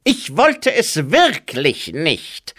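high, slow, loud figure voice; Pestilence's sickly drawl; oozing Pestilence. A narrator's reading. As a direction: let it ooze out slowly like pus. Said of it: "es klang nach hervorquellendem Eiter".

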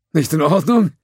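nervous echo. A narrator's reading. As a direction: echo it with fake innocence. Judge nervously echoing.

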